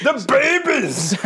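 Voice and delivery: high, drawn-out mock Scottish exclamation; Scottish accent. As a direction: stretch it out in high, hammy Scottish. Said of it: "That's Scottish now".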